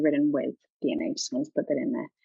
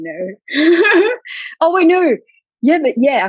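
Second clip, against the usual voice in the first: loud, high laughing burst; laughing too hard to answer; 11 semitones higher, 8 dB louder, slower than usual. Real, not acted. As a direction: crack up mid-answer. Laughing at the funny question.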